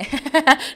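high, loud laugh. Real, not acted. Recalling being taken for Venezuelan, a high laugh. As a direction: burst out laughing at your story.

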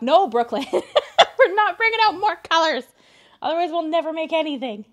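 high, loud, laughing no; laughing refusal. Real, not acted. Laughing at viewer's request.

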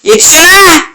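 high exasperated call; urgent shout. Real, not acted. Gamer tells player to catch up, urgent high shout.

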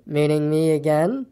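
slow, disbelieving donkey; slow, ponderous question; Eeyore. A narrator's reading. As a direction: draw it out in dull wonder.

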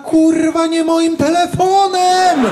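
high, loud, slow outraged yell; angry shout. Retold or imitated. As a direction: bellow it in outrage. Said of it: "A ja tak".

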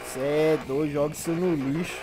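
a low, muffled mock voice scoffing; mock friend voice. Mock other voice, far timbre.

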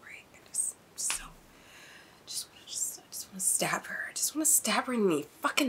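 furious whisper; hushed, murderous muttering under the breath; seething. Real, not acted. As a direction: whisper it haltingly, barely containing the anger. Seething at the villain.

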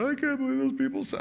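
high-pitched wailing protest, rushed; upset wail. Retold or imitated. Imitating the crying woman upset at what people said, high wail.

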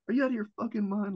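high, fast retold outburst; unhappy dad. Dad hearing son is joining the Marines, imitated outburst. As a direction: imitate an incredulous, upset father. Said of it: "they were not happy".

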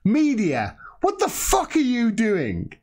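high-pitched, drawn-out exasperated rebuke; indignant public protest. Acted. The people rising up to scold the media, indignant. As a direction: voice the people's outrage, stretching the last words. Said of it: "the people have to rise up and go".

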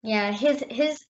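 ordinary talk

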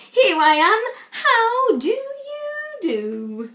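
loud, high, drawn-out dragon greeting; a big dragon booming a slow polite hello. Acted. Dragon greeting the Vikings, loud and drawn out.

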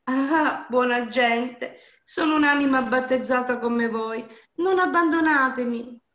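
high, loud pleading call for help; desperate cry. A narrator's reading. Bound Maria calling to passing peasants, desperate plea. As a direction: beg passing strangers not to leave. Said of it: "Maria però li udì e gridò".

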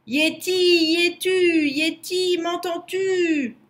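high, loud, drawn-out call; shouting. A narrator's reading. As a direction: holler for someone far off, dragging out the last word. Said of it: "cria Lou en arrivant en haut".

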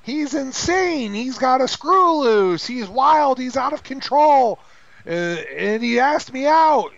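high, loud, drawn-out mock hysteria; mock hysterical protest. Woman telling her dad her date is insane, mock-hysterical. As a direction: wail it like a hammed-up overwrought woman.

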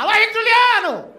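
high, loud stage shout back; cheering shout to the singers; calling out. Retold or imitated. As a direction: yell their names like a fan.

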